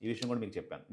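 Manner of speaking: ordinary delivery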